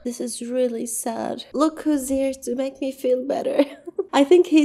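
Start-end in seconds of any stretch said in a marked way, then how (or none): 0.0-1.4 real tearful sadness. Real, not acted. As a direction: struggle to hold it together, sighing between phrases. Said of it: "sensed that I was crying".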